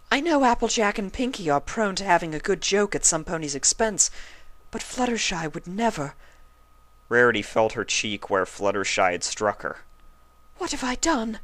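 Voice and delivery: high, remorseful voice; regretful realisation; dismayed Rarity. A narrator's reading. Rarity realising her mistake, high.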